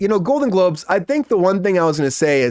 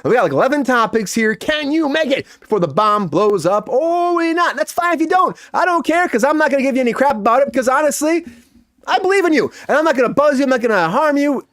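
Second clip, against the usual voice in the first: higher, bouncy host patter; host hyping the hot takes segment to guests; game show host; 6 semitones higher than usual. Acted.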